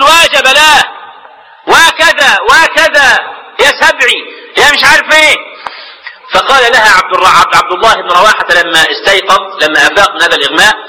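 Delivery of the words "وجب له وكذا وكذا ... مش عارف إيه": high, drawn-out mock keening; imitated wailing lament. Sister laments over her unconscious brother, imitated wailing. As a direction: wail each phrase like a mourning sister, half-acted. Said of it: "فجعلت أخته عمرة تبكي".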